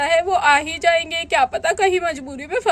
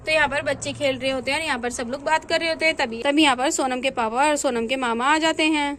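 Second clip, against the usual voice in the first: lower, softer narrator voice; story narration; 4 semitones lower, 3 dB quieter, faster than usual. A narrator's reading. Narrator tells the audience who is talking, in a soft storytelling voice. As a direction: set the scene quietly, storyteller style.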